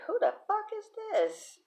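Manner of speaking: high-pitched baffled outburst; mock disbelief. Reacting to an unusually kind aunt, high mock bewilderment. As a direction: squeak it out, utterly thrown. Acted astonishment at aunt's kindness.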